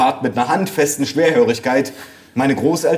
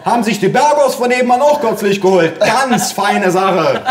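bellowing mother; 8 semitones higher, 4 dB louder than usual. A narrator's reading. Mother shouting gossip from the study, bellowing. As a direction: holler approval from another room, stretching the last words. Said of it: "brüllte meine Mutter aus dem Arbeitszimmer".

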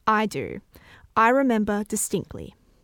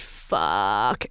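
long, low, stretched-out exclamation; huge drawn-out swear; 12 semitones lower than usual. A narrator's reading. Friend swears during a handstand, drawing it out. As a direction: let it out long and big. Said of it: "letting out a huge".

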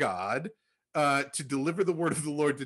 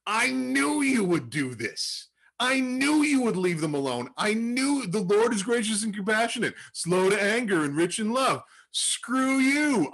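pissed off Jonah; 6 semitones higher, 4 dB louder than usual. Retold or imitated. Jonah ranting at God, furious and raised. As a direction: vent the fury of a prophet who saw it coming. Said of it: "and he's pissed".

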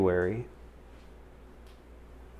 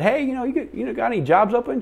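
casual asking; 4 dB louder than usual. Retold or imitated. Retold casual hallway request.

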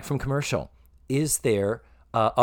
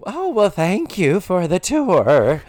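put-on prim older lady voice; 6 semitones higher, 5 dB louder, slower than usual. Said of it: "she's, you know".

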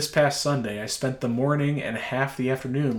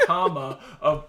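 laughing; 5 semitones higher, 7 dB louder, slower than usual. Real, not acted. Laughs while dictating.

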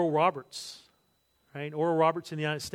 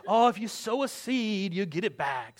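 slow, drawn-out preacher voice on Oh, if you sow a seed, dropping back on you get it back; mock televangelist; 3 semitones higher than usual. Preacher mocking TV prosperity preachers, slow televangelist drawl. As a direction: ham up the prosperity preacher's pitch. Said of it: "they always speak like that".

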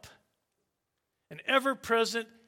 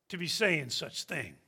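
usual speaking voice

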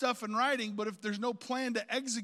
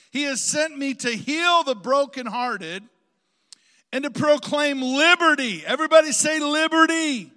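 full-force preaching; 3 semitones higher, 13 dB louder than usual. Real, not acted. Preacher proclaims liberty to the congregation, full-force preaching. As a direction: declaim it to the whole room, stretching key words.

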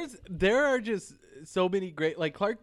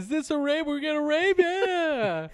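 high-pitched mock enthusiasm; hammed-up excitement; 11 semitones higher, slower than usual. Co-host quoting the movie's prankster bit, mock excited. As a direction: push it up high, ending on a drawn-out cheer.

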